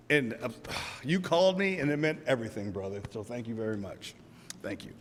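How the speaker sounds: thanking a friend, voice going quiet with emotion; choked up. Real, not acted. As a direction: let the thanks fade as emotion takes over. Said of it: "I'm gonna get really emotional".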